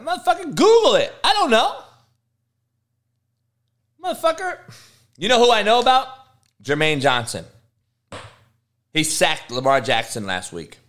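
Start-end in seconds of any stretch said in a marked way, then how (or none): 0.0-6.1 high, incredulous rant; high exasperated outburst. Real, not acted. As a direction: throw up your hands at a dumb question. Exasperated at a question.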